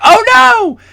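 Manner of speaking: high, loud yelp of panic; sudden alarm at the watch; panicked. Retold or imitated. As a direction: cry out on seeing the time. Realizes he missed the final.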